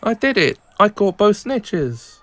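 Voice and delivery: high, exclaimed triumph; triumphant. Acted. Player who just caught both snitches, high and triumphant. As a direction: cry out the win, stretching the last words.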